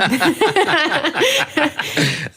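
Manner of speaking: trying to insist while cracking up; laughing helplessly. Real, not acted. To Father Michael, protesting through real laughter. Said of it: "It's just the way you said that".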